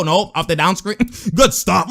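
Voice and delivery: normal talking voice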